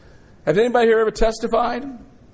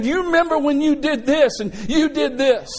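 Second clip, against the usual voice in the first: mocking accuser; 8 semitones higher than usual. Satan as accusing prosecutor.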